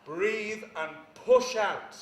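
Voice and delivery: stretched, forceful coaching demo; breathe and push. Shown as a demonstration. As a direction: demonstrate pushing the breath out through each word. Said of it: "Shakespeare's saying".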